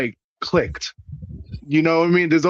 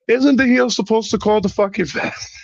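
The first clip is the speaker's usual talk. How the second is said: higher-pitched aggrieved protest; imitating an indignant outburst; retold indignant complaint; 6 semitones higher than usual. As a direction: complain in a raised pitch, acting out your own gripe. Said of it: "I said it just like that".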